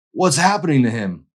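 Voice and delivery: loud, high alarmed yell. Acted. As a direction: yell it in panic. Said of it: "Josh yelled".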